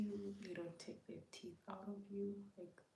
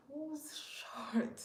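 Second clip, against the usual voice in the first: startled question; 10 semitones higher, 6 dB louder than usual. Retold or imitated. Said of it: "I'm like".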